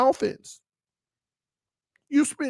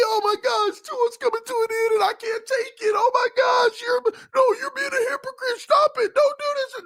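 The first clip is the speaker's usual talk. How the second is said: much higher, wailing whiny fan voice; mock crying fan; 13 semitones higher than usual. A streamer mocks fans crying over Tua's decline, high wailing. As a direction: wail like a fan who can't take it. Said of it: "I'll just see crying".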